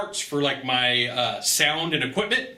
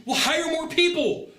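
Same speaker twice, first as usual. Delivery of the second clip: exasperated retort; 12 semitones higher than usual. Retold or imitated. Retorting to the store manager.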